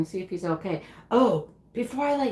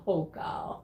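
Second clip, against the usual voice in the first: whispered; 3 semitones lower, 6 dB quieter than usual. Retold or imitated. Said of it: "I'm like".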